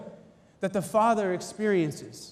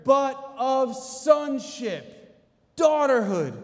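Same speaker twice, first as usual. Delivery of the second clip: slow, deliberate reveal, louder; drawn-out triumphant answer; slow emphatic; 5 semitones higher, 4 dB louder, much slower than usual. Acted. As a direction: land the answer slowly and firmly.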